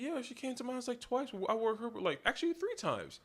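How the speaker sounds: high, squeaky nervous admission; imitating himself panicking to his friend; retold panic. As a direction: pitch up as if caught out. His own retold panicky answer.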